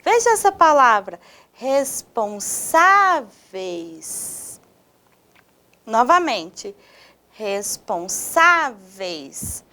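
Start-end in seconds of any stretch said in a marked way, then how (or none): 1.5-4.6 RESPONSÁVEIS very slowly, syllable by syllable, long SÁ; stressed SÁ syllable. Shown as a demonstration. Teacher asking students to spot stress, slow RESPONSÁVEIS with held SÁ. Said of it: "O sa". 7.3-9.6 RESPONSÁVEIS very slowly, syllable by syllable, long SÁ; stressed SÁ syllable. Shown as a demonstration. Teacher asking students to spot stress, slow RESPONSÁVEIS with held SÁ. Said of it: "O sa".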